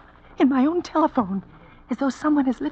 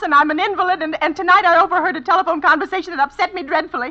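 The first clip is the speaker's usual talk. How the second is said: higher and louder, building to near hysteria; rising panic; 6 semitones higher, 4 dB louder than usual. Acted. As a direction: spiral into panic. Panicking invalid on phone.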